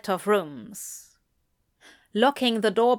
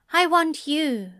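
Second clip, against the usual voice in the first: higher-pitched, plainly blunt confession; 7 semitones higher than usual. A narrator's reading. Angel declaring himself to the demon, blunt. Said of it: "he says bluntly".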